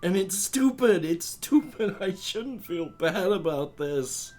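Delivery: high, halting, emotional outburst; worked up and apologetic, pitch climbing, breaking off mid-phrase; upset and embarrassed. Acted. Hurt human confronting cryptid friends at the bar, high and emotional.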